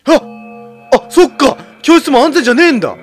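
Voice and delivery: loud startled shout; shouted realization. Real, not acted. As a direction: cry out in alarm. Sudden realization during horror game.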